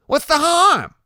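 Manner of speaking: very high, loud protest; Loretta, insisting. Acted. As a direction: argue back in Loretta's high voice. Loretta argues with Iggy.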